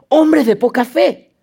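slow, lightly voiced stern rebuke; a hint of Jesus scolding; Jesus rebuking. Jesus to frightened disciples, mild stern rebuke. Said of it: "Jesús les contestó".

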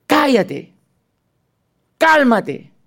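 high, firm command; Jesus rebuking the storm. Acted. Jesus speaking to the storm, lightly acted command. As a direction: hush the storm with authority. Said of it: "le habla Jesús a la tormenta".

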